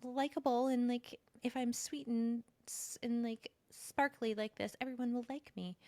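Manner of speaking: put-on high, sparkly, sugary girl voice; sweet people-pleaser. Mocking her old people-pleasing self in a high sweet voice. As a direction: play the sweet girl desperate to be liked. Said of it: "And it's not about, like".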